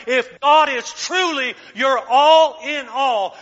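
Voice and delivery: loud, high, fired-up preaching; preaching at full force. Acted. Pastor rousing the church to embody God's glory, full-force preaching. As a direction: drive it home to the congregation.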